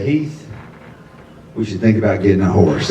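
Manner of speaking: lightly voiced girlfriend, hushed; soft girlfriend quote.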